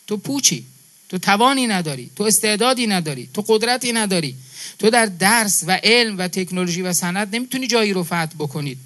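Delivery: usual speaking voice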